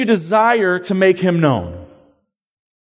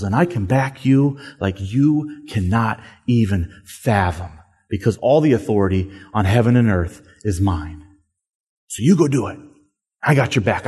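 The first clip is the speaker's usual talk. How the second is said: gentle reassuring Jesus; 8 semitones lower than usual. Pretend. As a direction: reassure a scared friend, lower and hushed. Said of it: "I bet the way he said it was like this".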